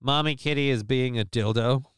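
normal talking voice

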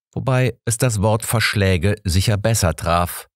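ordinary delivery

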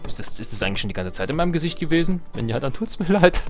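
ordinary talk

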